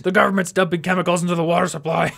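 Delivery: loud mock conspiracy rant; hammed-up paranoid yelling; conspiracy theorist.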